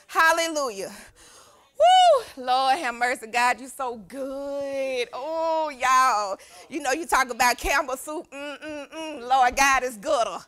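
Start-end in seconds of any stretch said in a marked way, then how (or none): 1.8-2.4 high, loud "woo!"; praise whoop. Real, not acted. Preacher overcome by God's goodness, high whoop. 8.3-9.3 soft, appreciative "mm, mm, mm"; savouring hum. Real, not acted. Preacher likening God to Campbell soup, soft savouring hums.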